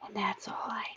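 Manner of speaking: soft whisper as the cat; whispered cat thoughts. Pretend. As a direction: breathe the cat's happy thoughts. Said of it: "She's like".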